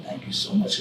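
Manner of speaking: breathless whispered gratitude; whispered thanks. Retold or imitated. Grateful mother thanks the stranger, whispered. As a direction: barely voice the thanks. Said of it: "She said".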